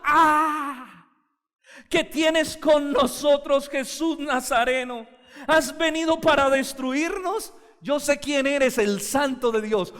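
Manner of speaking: loud, high demonic cry, dragged out slowly; a preacher voicing the demon's scream at Jesus. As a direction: shout it as a tormented demon confronting Jesus. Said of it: "un espíritu inmundo que dio voces".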